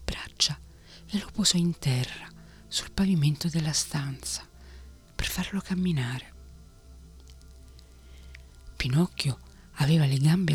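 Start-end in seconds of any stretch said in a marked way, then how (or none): none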